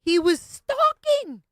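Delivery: high, slow, loud mocking parrot; mock mimicry. Co-host parrots her story, high, slow and loud.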